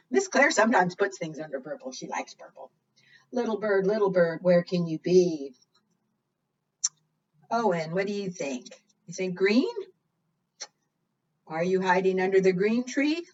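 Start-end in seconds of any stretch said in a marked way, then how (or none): none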